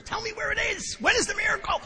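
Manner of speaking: high, whiny demand for the miracle; mock a baffled newcomer pleading. Mocking the slogan as a clueless newcomer, high and whiny. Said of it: "it's stupid when you're a newcomer".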